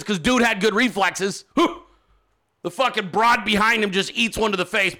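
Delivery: ordinary delivery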